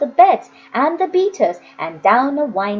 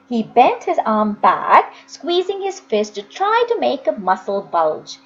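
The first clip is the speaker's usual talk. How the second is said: usual speaking voice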